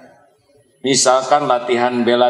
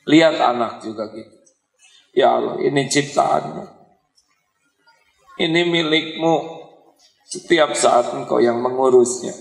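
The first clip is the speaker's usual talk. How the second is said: prayerful plea. Acted. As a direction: pray humbly, asking forgiveness. Opening prayer held apart from talk.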